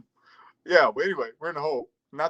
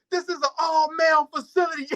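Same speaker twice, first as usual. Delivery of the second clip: high laughing punchline; 11 semitones higher, 4 dB louder than usual. Retold or imitated.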